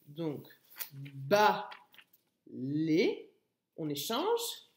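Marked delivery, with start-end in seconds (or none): 0.9-3.3 "ba, les" low, with a long gap; teacher separating "ba, les" clearly; split into syllables. Shown as a demonstration. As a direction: say "ba", wait, then "les". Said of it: "La première syllabe".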